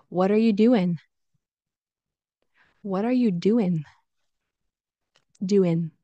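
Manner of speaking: "doing" ending on N, as "doin'"; casual IN ending. Shown as a demonstration. Teacher repeating casual form for learners, IN ending. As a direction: link "What are you" and finish "doin'". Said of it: "you'll hear in".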